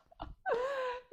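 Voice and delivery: high-pitched teasing laugh; high giggle. Acted. Principal laughing over a night out, high giggle.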